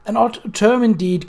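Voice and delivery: ordinary delivery, nothing marked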